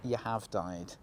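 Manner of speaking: low, soft game-over narrator. Pretend. Game narrator announcing the player's death, low and quiet. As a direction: drop it down into a mock-solemn announcement. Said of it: "it's the voice of the game".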